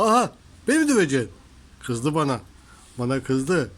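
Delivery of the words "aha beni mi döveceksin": mock surprise. Real, not acted. Cat swipes at her.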